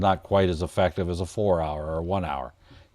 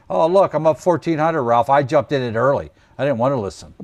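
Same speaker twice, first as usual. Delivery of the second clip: higher, louder boast of an early entry; overeager trader; 6 semitones higher, 7 dB louder than usual. Acted. Trader bragging to Ralph about jumping in early, overeager. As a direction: play the cocky trader who jumped in.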